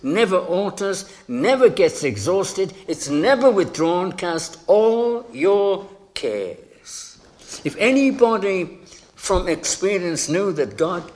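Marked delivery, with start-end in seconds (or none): 4.1-7.2 all drawn out long and high; ringing, slow emphasis on all; stress on all. Shown as a demonstration. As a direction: stretch all and each word after it, very slowly. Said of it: "Did you pick up the emphasis?".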